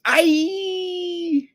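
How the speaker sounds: very high, drawn-out mock squeal; long falsetto child's "aye"; imitated frustrated child's squeal. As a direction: mimic a frustrated kid shrieking. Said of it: "articulates the frustration".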